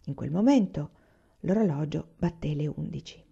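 ordinary delivery, nothing marked

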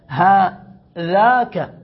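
هذاك stretched very slowly, falling at the end; drawn-out correct form. Shown as a demonstration. Teacher showing the allowed form, stretched. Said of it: "إذا لحقت هاء التنبيه".

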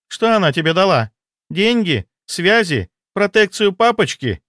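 high-pitched, scornful questions; jealous accusation. A narrator's reading. Jealous mistress asking what her rival gave him, high and accusing.

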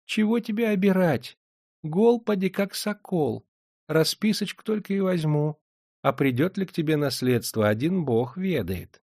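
put-on high, sweet old woman voice; sly old moneylender. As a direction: coax him with honeyed old-crone talk. Moneylender's voice held.

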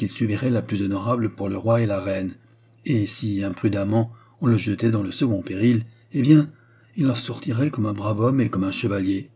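ordinary delivery, nothing marked

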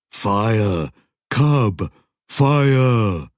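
troll shout, building. Acted. Troll pressing Joshua to act.

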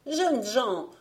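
acted pleading voice. A narrator's reading. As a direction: appeal to them as a needy old woman. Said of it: "dit-elle d'une voix suppliante".

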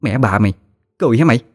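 rising from a growl to a high yelling rant; chửi mắng giận dữ, càng lúc càng the thé; furious cursing. A narrator's reading. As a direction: scold her while beating her, getting shriller. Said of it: "vừa quánh vừa chửi".

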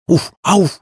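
high, happy creature yips; joyful little monster. A narrator's reading. Little monster bouncing before the play, joyful high yips. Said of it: "радостно запрыгал Кузьма".

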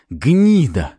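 loud, high burst of abuse; involuntary curse. A narrator's reading. Second cop cursing hero, angry outburst. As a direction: let the insult burst out. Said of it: "врывалось у второго".

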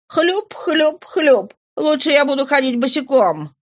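put-on high, insolent young woman's protest; rude defiance. Daughter refuses worn shoes to her father, rude defiance. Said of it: "с грубостью молодой девушки".

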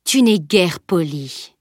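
slow, deep menacing breath of a voice; low menacing voice. Acted. Stranger lifting the driver off the ground, slow menace. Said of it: "souffla l'inconnu d'une voix grave".

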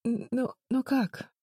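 high, slow, stammering sob; sobbing. Acted. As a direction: stumble over the words through tears. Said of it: "всхлипнула Агни".

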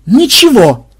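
high, loud single-word blurt; emphatic joint denial. A narrator's reading. Ксен and Мелисса deny everything together, loud blurt. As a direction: deny it flatly and at once. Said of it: "хором ответили Ксен и Мелисса".

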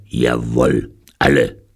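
loud, blunt, low-voiced confirmation; gruff convict. A narrator's reading. Convict confirming they are all lifers, gruff and blunt. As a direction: answer bluntly in a rough convict voice.

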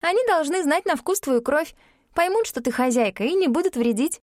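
put-on a child calmly explaining; young girl voice. A narrator's reading. Alexa explaining.

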